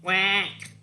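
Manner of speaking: single duck quack; short cartoon duck call; imitated quack.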